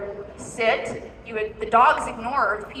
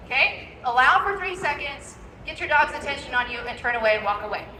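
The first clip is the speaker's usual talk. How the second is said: ordinary delivery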